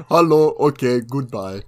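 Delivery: put-on terse shop clerk with a thick Indian accent. Mimicking all that guy ever says, thick Indian accent. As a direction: imitate a man of few words in an Indian accent. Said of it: "had the thickest Indian accent".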